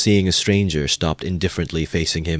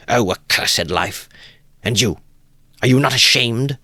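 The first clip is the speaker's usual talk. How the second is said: bitter reproach; 7 semitones higher, 4 dB louder than usual. A narrator's reading. Wife turns on the guest, loud bitter reproach. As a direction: curse life then shame him.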